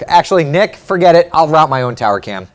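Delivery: loud, high shout to a coworker; calling out. Real, not acted. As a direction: call off the request across the studio. Calls to crew member Nick.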